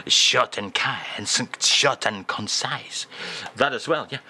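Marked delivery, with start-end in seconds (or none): none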